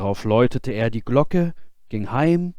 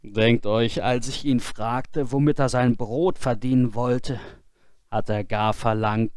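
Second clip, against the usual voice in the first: usual speaking voice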